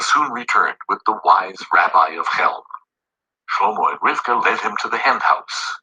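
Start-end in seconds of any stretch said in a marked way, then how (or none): none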